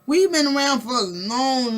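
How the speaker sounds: choked, crying shout of outrage; half-sob, half-yell the protest; tearful indignant yell; crying outburst. Acted.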